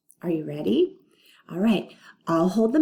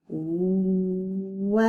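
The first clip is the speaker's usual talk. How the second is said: one long stretched breath; stretched smooth blend. Shown as a demonstration. Teacher modeling a smooth blend, drawn out. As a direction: glide the sounds together slowly without stopping. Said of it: "when we smooth blend, we wait... stretch that word out".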